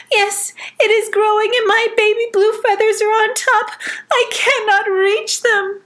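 very high distressed bird voice; desperate, she can't reach them; mother bird crying. Acted. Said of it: "cried Mama Blue Feather".

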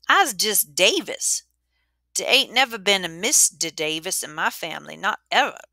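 Davis's voice. Acted. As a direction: drag it out, gently correcting the girl. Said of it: "he told me yesterday".

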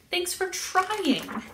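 high, slow, small character voice; lightly voiced bunny. A narrator's reading. Bunny thanks Cat for trying, small high voice. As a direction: say it slowly in a little bunny voice. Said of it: "Bunny said".

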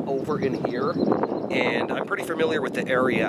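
usual speaking voice

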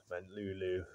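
put-on expressive read-aloud for a child, with pauses; bedtime story narration. A narrator's reading. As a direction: read a picture book at bedtime.

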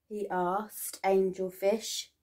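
ordinary delivery

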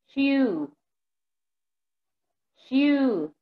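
each word clearly modelled with long pauses between; slow, careful teacher model for the class to echo; repeat-after-me drill. Shown as a demonstration. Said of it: "Repeat after me, please".

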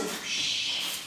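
unvoiced hush to keep quiet; whisper. Shown as a demonstration. Coach whispering so the sleeping guard won't wake. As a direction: sneak up, shh. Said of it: "Aber seid ganz leise".

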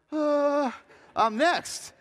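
high, loud whimper of dread; hammed-up panicky groan; mock dread. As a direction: play the terrified kid up next. Scared young batter up next.

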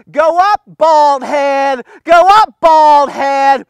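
mock the prophet like rowdy boys yelling; jeering youths. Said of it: "watch what they said".

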